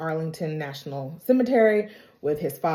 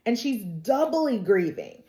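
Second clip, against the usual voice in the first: ordinary talk, nothing marked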